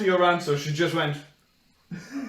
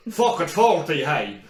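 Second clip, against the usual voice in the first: giving up; 7 dB louder, slower than usual. Retold or imitated. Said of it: "and you just went".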